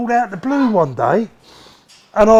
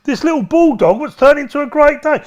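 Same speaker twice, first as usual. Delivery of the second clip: retold indignant complaint; mock outrage; 7 semitones higher than usual. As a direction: play up the disbelief. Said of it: "I said".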